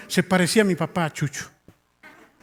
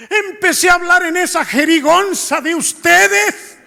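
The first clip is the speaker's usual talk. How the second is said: high, loud, emphatic shouting; full-voiced, high-pitched proclamation; imitating excited testimony; 12 semitones higher, 7 dB louder than usual. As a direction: act out an astonished convert testifying to the crowd. Father testifies excitedly before congregation.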